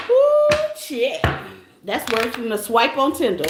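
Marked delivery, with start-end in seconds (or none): 0.1-1.2 high, loud squeal of "Ooh, shit"; mock surprise. Real, not acted. Reader gasps at zapping a troll, high squeal. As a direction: yelp in playful shock. Reacting to her own zap.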